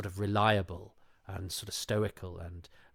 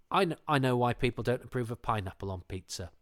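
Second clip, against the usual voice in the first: normal talking voice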